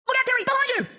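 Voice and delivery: very high, urgent warning cry. A narrator's reading. Friend warns Terry of danger behind him, urgent cry.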